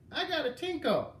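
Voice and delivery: raspy granny voice from the commercials; sandpaper voice. A narrator's reading. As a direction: croak it like a crusty old granny. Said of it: "in a sandpaper voice".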